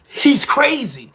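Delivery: slow, loud mocking "He's crazy"; mock verdict. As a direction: stretch out "crazy" like the scoffing crowd.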